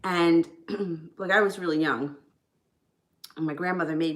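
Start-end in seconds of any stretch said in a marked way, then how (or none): none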